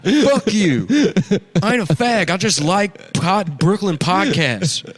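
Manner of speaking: loud, high, laughing yell; angry cowboy denial. Acted. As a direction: shout him down, cracking up.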